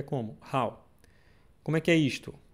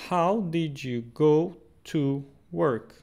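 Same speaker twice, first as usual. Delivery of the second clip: slow model sentence; much slower than usual. Shown as a demonstration.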